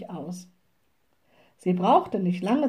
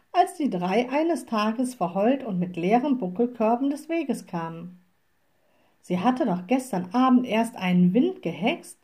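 plain reading voice

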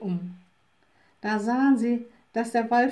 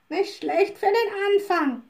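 high raven voice, pleased; a grudging raven who is pleased for once; approving raven; 7 semitones higher than usual. Acted. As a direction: raven Abraxas praises the little witch. Narrator voices the raven Abraxas.

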